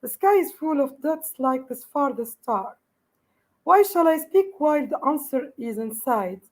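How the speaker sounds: normal talking voice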